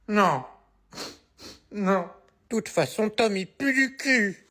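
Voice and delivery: a lightly voiced little kid whining to mum; whiny child. Little boy complains to mum about Tom, whiny kid voice. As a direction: sulk and complain like a small boy.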